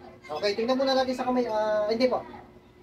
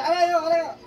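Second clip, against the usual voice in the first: high, louder mock wails; imitating the earlier cries; 8 semitones higher, 5 dB louder than usual. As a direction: replay the sick man's odd cry. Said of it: "Tingnan mo kanina". (ginagaya ang iyak sa sakit)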